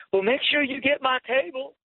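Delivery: high, bright playful waitress. Retold or imitated. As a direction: squeak it out like a cheeky waitress. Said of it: "she goes".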